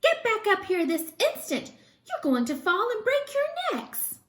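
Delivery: high, loud scolding; a frightened mother bird's command. Acted. Mama Bird orders the babies back up, high scolding.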